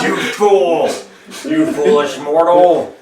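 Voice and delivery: roaring furious villain, slow; dark lord. Acted. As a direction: bellow it like an enraged demon lord. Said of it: "He goes".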